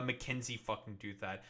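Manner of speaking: ordinary delivery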